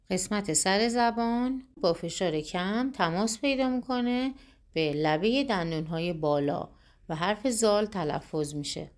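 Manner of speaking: usual speaking voice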